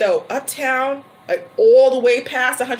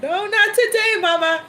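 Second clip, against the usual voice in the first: much higher, laughing through it; laughing refusal; 9 semitones higher than usual. Retold or imitated. Telling the server no meat today, high and laughing. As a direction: turn down the meat with a giggle. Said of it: "I'm like".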